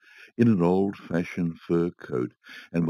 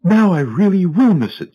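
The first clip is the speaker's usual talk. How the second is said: high, loud, anguished cry; cried out; 11 semitones higher, 8 dB louder than usual. A narrator's reading. Wife cries out in the hall that she will miss it, high and loud. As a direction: wail it in despair. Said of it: "she cried".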